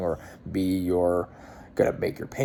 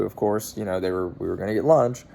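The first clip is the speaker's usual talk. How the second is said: normal talking voice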